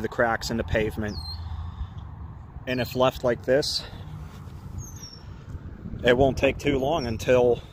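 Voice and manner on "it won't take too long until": ordinary delivery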